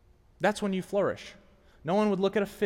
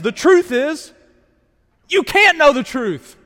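raised, high-pitched impassioned confrontation; forceful challenge; 8 semitones higher, 11 dB louder than usual. Acted. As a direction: fire it at the objector, loud and high. Acted challenge to imagined objector.

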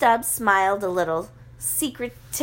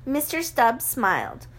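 usual speaking voice

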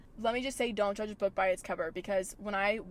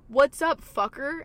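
rowdy fan greeting; 5 semitones higher, 7 dB louder, slower than usual. Retold or imitated. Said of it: "It's literally like".

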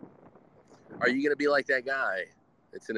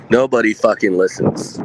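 much louder, stressing nobody hard; emphatic declaration; 12 dB louder than usual. Real, not acted. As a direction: hammer the point home to listeners. Repeating the moral emphatically.

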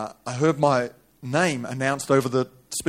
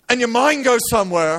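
high, raised preacher's cry; high-pitched dramatic sermon telling; impassioned preaching; 9 semitones higher, 6 dB louder than usual. Acted. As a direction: preach the story at full pitch. Preaches at full force.

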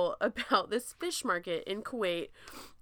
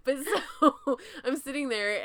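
slow tipsy laugh; 6 semitones higher, 3 dB louder, slower than usual. Real, not acted. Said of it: "I've had a little bit of wine tonight".

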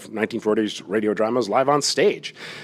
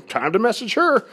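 high-pitched, self-mocking eagerness; hammed-up bright idea; 12 semitones higher than usual. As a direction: pipe up as if it's a great idea. Acting out drunk late-night impulse.

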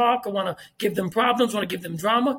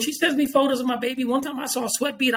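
ordinary talk, nothing marked

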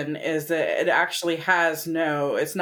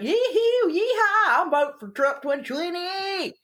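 exaggerated Southern cowboy with a high yee-haw; Southern accent; 9 semitones higher than usual. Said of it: "they wanna hear that Southern accent".

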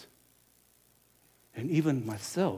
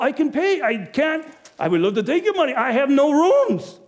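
loud, high, emphatic no; insistent refusal; 10 semitones higher, 7 dB louder than usual. Acted. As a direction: hammer home that every room is full. Innkeeper refusing Joseph insistently.

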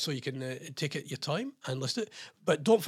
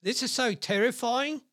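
mock horror again; 7 semitones higher than usual. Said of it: "One user commented".

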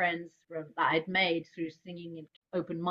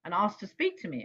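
ordinary talk, nothing marked

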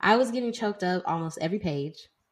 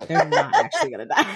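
laughing through the words; giggling at her own hope; breaking into real laughter; 10 semitones higher, 7 dB louder, faster than usual. Real, not acted. Laughing at own hopeful theory.

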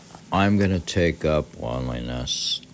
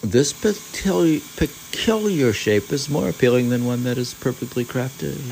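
pitched up reading voice; host reading the passage on the hat's shape, higher; literary reading; 8 semitones higher, 4 dB louder than usual. Acted. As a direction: savour the phrase as you read. Reads Basho text aloud.

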